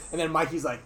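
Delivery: normal talking voice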